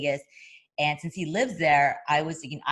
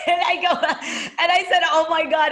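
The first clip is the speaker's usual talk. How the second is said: much higher and louder, laughing through it; 13 semitones higher, 9 dB louder than usual. Real, not acted. Laughing at Al's advice before the induction. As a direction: burst out laughing in delight.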